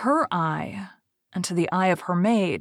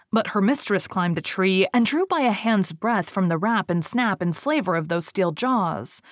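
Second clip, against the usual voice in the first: ordinary delivery, nothing marked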